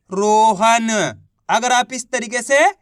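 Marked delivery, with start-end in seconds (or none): 0.1-0.9 रोहन drawn out syllable by syllable; blended reading. Shown as a demonstration. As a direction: join the sounds slowly into one long रोहन. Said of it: "तो मिलके बन जाएगा". (रोहन को धीरे, खींचकर पढ़ते हुए)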